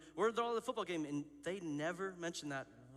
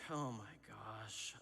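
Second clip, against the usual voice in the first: low, soft, drawn-out dismay; sinking dread; 7 semitones lower, 7 dB quieter, much slower than usual. Retold or imitated. Retelling getting the boss's text, sinking dread. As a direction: let it sag slowly, heart sinking. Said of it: "my heart sinks".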